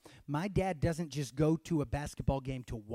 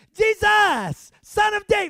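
high, ear-splitting shout; yelling; 12 dB louder than usual. Acted. Blind Bartimaeus yelling next to someone's ear, full shout. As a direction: yell blindly in any direction. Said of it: "just yelling in any direction".

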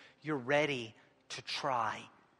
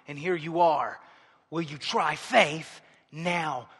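normal talking voice

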